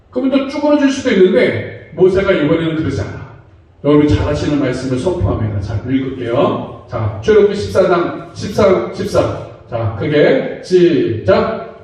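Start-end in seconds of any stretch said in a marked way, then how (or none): none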